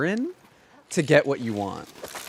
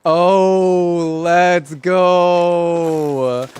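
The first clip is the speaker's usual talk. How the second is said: excited cheer; 5 dB louder, much slower than usual. Real, not acted. Celebrating the catch.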